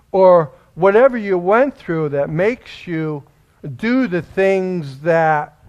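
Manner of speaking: loud, high preaching cadence; fiery preaching. Acted. Pastor exhorting his congregation, loud and fervent. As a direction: drive the point home to the church.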